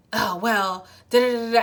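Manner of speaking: a loud, huffy brush-off; hammed-up defensive huff; mock defensive. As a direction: play the offended one, loud and dismissive. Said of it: "kinda become defensive".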